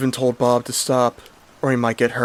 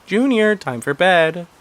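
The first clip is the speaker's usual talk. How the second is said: high, louder mother calling her son; higher-pitched mom calling out; Mom Asparagus calling; 8 semitones higher, 5 dB louder, slower than usual. Retold or imitated. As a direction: call him up to bed, motherly. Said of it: "Mom Asparagus saying".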